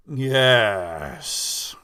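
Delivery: low, distant servant's reply; deep tall man. Acted. The tall man replies to the medium in a low, distant voice. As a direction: answer in a low, detached voice.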